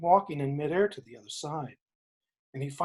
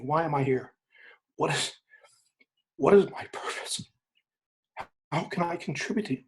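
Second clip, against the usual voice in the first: prayer; 3 semitones lower than usual. Acted. Acted prayer asking for purpose.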